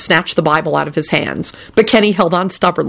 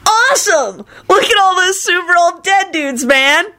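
high, thrilled squawk; excited shriek; 12 semitones higher than usual. A narrator's reading. Kenny gawking at super old dead dudes, shrieking. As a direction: marvel at it at the top of the voice range. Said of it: "Kenny shrieked".

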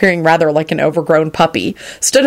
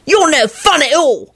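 put-on high, drawn-out boyish whine; whining; 10 semitones higher than usual. A narrator's reading. Victor sulking at Remus, whining. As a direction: complain slowly in a high whine. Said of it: "Victor whined".

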